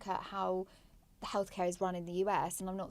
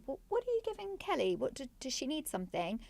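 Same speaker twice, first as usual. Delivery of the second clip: lightly voiced mum, pitched up and urgent at the start; mum's worried question; 7 semitones higher than usual. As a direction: play a mildly alarmed mum checking on her daughter. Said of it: "my mum happened to say".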